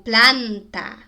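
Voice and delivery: "planta" with a loud, open ah; wide open A. Shown as a demonstration. Teacher giving an example, emphatic open vowel. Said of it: "A é bem aberto".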